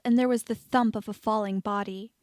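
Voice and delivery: ordinary delivery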